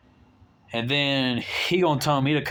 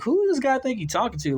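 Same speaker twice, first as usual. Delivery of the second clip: higher, indignant disbelief; 11 semitones higher than usual. Retold or imitated. Complaining about a client bossing him, high and indignant. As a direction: pitch it up like you can't believe the nerve.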